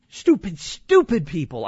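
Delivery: loud, exasperated outburst. Real, not acted. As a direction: vent your frustration. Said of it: "I'm sorry".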